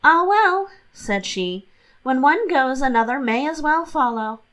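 mock-philosophical young wife; breezy Catherine. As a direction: toss it away lightly. Catherine shrugs off the loss.

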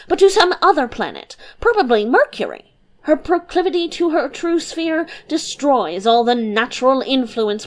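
quick, eager lecturing voice; flighty philosopher. Acted. Kopy Keck lecturing Humdrum, quick and insistent. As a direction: rattle on, cutting him off.

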